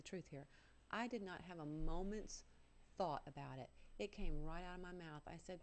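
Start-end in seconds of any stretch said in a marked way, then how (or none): none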